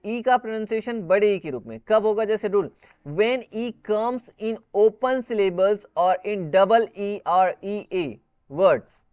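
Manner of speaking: ordinary talk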